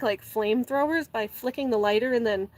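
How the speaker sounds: ordinary talk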